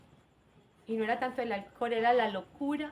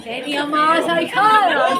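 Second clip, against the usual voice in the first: loud slow punchline; 5 semitones higher, 15 dB louder, slower than usual. Real, not acted. Punchline of funny story.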